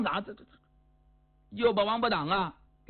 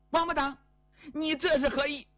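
high-pitched indignant demand; indignant outburst; 7 semitones higher than usual. Acted. Hostess confronts the guest who kicked over her banquet, indignant. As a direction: play her affronted, voice shooting up.

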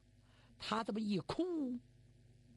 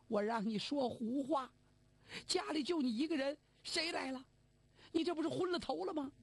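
higher and fast, a mother berating her son; scolding old mother; 5 semitones higher than usual. Acted. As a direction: splash him and scold, speeding up. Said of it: "老太太接过来". (老太太连珠炮似地训斥儿子)

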